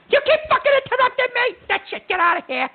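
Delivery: high, yelled blow-up at the caller; furious outburst. Acted. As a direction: snap and throw the caller out. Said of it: "I get irritated and I start screaming".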